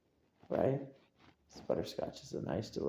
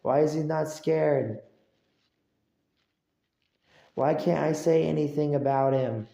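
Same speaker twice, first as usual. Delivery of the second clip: higher, louder exasperated whine; mock baffled complaint; 5 semitones higher, 7 dB louder than usual. Imitating the guy puzzled that he's not scared, loud whine. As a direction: gripe in disbelief. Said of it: "He's gonna say".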